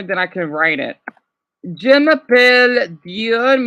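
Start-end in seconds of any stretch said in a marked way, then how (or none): 1.7-3.7 Je m'appelle Dion slow and louder; French introduction demo. Shown as a demonstration. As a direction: introduce yourself in French, slowly. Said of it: "which means my name is Dion".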